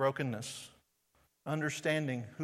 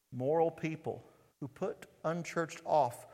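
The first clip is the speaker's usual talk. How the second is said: normal talking voice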